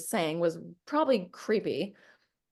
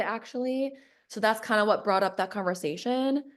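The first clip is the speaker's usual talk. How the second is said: ordinary talk, nothing marked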